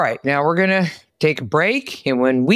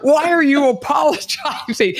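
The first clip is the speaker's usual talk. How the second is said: laughing disbelief; 9 semitones higher than usual. Real, not acted. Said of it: "Like, what?".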